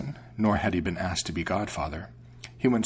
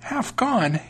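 very high mouse exclamation; astonished mouse; 14 semitones higher, 3 dB louder than usual. A narrator's reading. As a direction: echo the name in high-pitched disbelief. Mouse echoes name in disbelief.

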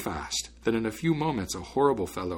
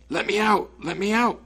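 high, loud, urgent cries; trapped voice calling; 11 semitones higher, 5 dB louder than usual. A narrator's reading. Trapped spirit begging the student for release, calling out. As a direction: shout to be freed, high and strained. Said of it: "heard a muffled voice calling".

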